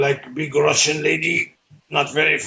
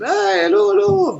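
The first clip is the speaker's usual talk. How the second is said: high, lilting hello hello; sing-song greeting; 3 dB louder than usual. Retold or imitated. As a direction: chirp a merry hello to passers-by. Passengers greeting again.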